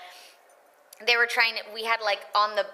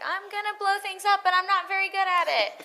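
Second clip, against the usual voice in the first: comic relief; 12 semitones higher than usual. Acted. Voice actor playing her usual comic relief, high-pitched and goofy. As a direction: play the goofy sidekick, pitched way up. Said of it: "cast as the comic relief".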